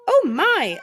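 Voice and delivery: high, loud "Oh my!" of a startled lady; shocked high exclamation; startled gasp. Acted. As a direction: cry out as the wind lifts you. Lady lifted off seat.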